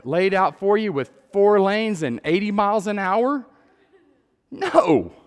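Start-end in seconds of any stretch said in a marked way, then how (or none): 4.5-5.1 a sudden high, loud "No"; emphatic no. Real, not acted. Pastor answering his own question to the crowd, loud and high. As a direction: slam the answer down.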